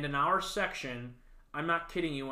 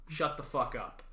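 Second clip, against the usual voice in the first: normal talking voice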